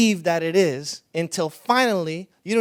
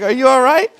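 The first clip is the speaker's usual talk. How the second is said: loud, high, startled check-in; startled concern; 7 semitones higher, 12 dB louder than usual. Retold or imitated. Pastor startled by the woman's outburst, loud and high. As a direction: blurt it out, caught off guard. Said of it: "I was like".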